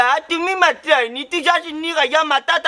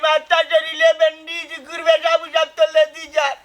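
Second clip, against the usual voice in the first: sobbing wail. Acted. Injured man bemoaning bandages and debt, sobbing wail. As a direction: wail and sob through the whole complaint.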